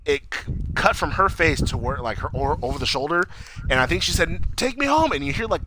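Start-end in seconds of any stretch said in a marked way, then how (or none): 4.6-5.1 pleading Audrey. Retold or imitated.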